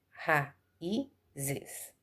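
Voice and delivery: "raízes" broken into ra-í-zes, each syllable set apart; teacher sounding out "raízes" as ra-í-zes; split into syllables. Shown as a demonstration. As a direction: spell out "raízes" in three separate beats for the class. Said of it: "ela é separada assim".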